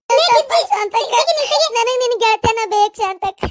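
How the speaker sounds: high, loud, sobbing apology with ನನ್ stressed; pleading with Shantakka to take her back, crying; tearful plea. Acted. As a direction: beg forgiveness through tears, pitch breaking up. Begging friend's forgiveness, weeping.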